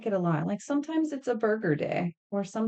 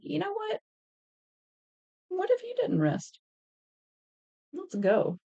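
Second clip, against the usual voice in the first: teasing body voice; 11 semitones higher than usual. Retold or imitated. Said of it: "the body's like".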